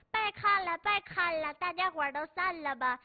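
high, loud call to break up the crowd; old grandma shooing neighbours. Retold or imitated. Great-grandmother ordering the neighbours out, loud and high. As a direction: shoo everyone out of the house. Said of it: "然后当时就说".

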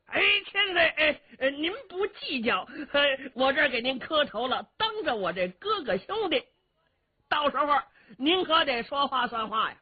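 high, giggly voice of a sly rough warrior; Cheng Yaojin's voice. Acted. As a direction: play the cunning bumpkin, chuckling and stammering.

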